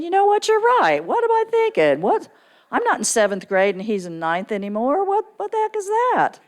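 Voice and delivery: high, loud incredulous self-scolding; exasperated realization. Retold or imitated. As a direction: catch yourself acting like a kid, loud and high. Retold reply to husband.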